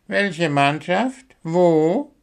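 slow, high bewildered questions; Willi, dumbfounded. A narrator's reading. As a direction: ask as if totally lost, pitched high. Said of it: "war Willi entgeistert".

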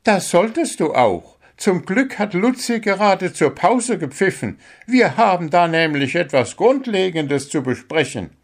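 a little bird coach giving his captain a sharp dressing-down; scolding bird coach. Acted. Coach bird scolding the sent-off captain.

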